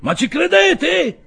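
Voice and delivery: very high, slow outraged shout; loud, drawn-out incredulous cry; appealing to crowd. A narrator's reading. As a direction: shout your disbelief to a whole crowd. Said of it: "continuò il vetturino rivolgendosi alla folla".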